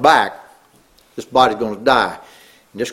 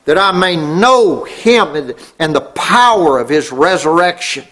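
raised, stretched key words, sermon cadence; fervent preaching; 5 semitones higher, 3 dB louder than usual. Real, not acted. Preacher quoting Paul to congregation, fervently. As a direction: preach it out, voice lifted high on the big words.

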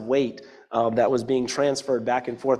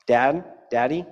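tender Daddy. Shown as a demonstration. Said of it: "extreme term of endearment".

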